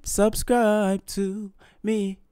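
slow, drawn-out subscribe call; drawled subscribe outro; outro plug. Real, not acted. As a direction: stretch out subscribe like a sign-off. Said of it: "drawn-out outro call to subscribe".

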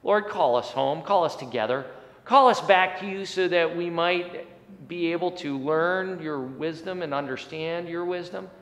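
ordinary talk

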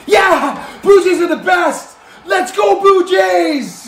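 loud, high cheering for the Blue Jays; cheering fan. Acted. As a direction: yell it like a fan in the stands. Sports fan cheering his team.

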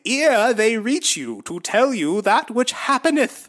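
high, slow, solemn biblical preaching; lofty old-timey Jesus voice, drawn out. Retold or imitated. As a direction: intone like a pompous prophet. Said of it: "That's his Jesus".

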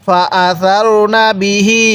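"ফা আসারনা বিহি" drawn out very slowly, each vowel stretched; slow recitation demo. Shown as a demonstration. Teacher reciting a Quran phrase for students to repeat, very slow. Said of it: "পড়ুন আমার সাথে".

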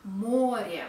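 Море with a loud, full "MO"; stressed o. Shown as a demonstration. Teacher showing stress, Море as "MO-rye". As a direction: punch the first syllable of Море. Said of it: "o is stressed".

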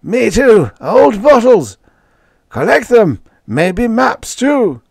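very high, loud manic crowing; crazed old men. A narrator's reading. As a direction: shriek it with mad delight.

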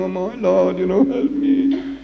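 slow, high imitated plea breaking upward; a hint of sobbing prayer to the Lord; crying plea. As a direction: lightly act out a tearful cry for help. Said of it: "because he was crying to himself".

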